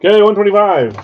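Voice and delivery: loud, rapid auctioneer call. Real, not acted. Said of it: "I just sound like an auctioneer".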